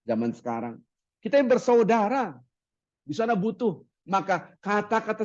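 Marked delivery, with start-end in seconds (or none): none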